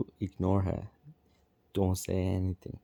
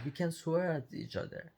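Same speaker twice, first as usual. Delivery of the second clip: higher, indignant mock Karen voice; 9 semitones higher, 4 dB quieter than usual. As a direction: play the entitled Karen, pitched up. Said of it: "And she was like".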